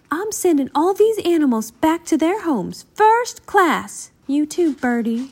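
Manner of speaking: high-pitched, grand pronouncement; high, emphatic rich-lady voice. A narrator's reading. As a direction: announce it as a wealthy lady taking charge. Rich lady takes charge.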